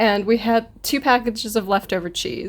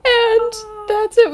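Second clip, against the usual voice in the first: high, slow, breaking into laughter; real helpless laughter; laughing; 12 semitones higher, much slower than usual. Real, not acted. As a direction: lose it to laughter on the last words. Cracking up at the memory.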